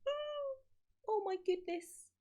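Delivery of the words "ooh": ordinary delivery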